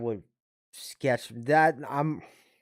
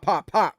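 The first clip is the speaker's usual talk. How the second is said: mock gunshots; 7 semitones higher, 6 dB louder than usual. Imitating gunshots.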